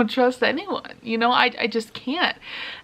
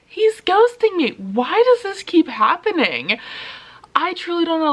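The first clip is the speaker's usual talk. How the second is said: exasperated; 7 semitones higher than usual. Real, not acted. Venting to viewers about a guy ghosting her, exasperated. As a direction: vent it with pitch jumping up.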